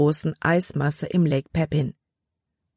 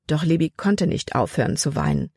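usual speaking voice

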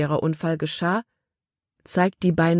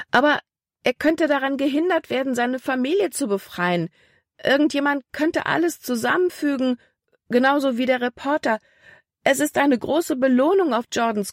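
much higher, urgent and anxious; frantic; 9 semitones higher than usual. A narrator's reading. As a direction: spill the fears out in a rush of worry. Libby frantic about Jordan's danger. (ängstlich drängend, viel höher)

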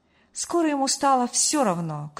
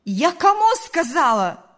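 high, loud scolding yell; loud, high-pitched reprimand; angry mother's shout; 7 semitones higher, 8 dB louder than usual. A narrator's reading. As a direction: shout it as a mother demanding obedience. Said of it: "закричала мать".

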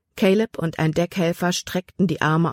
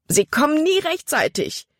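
much higher, fearful outcry; terrified; 10 semitones higher than usual. A narrator's reading. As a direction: despair as the boat lags behind. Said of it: "Calebs Stimme war schreckerfüllt". (erschrockener Ausruf, viel höher)

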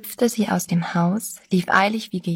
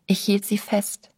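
ordinary delivery, nothing marked

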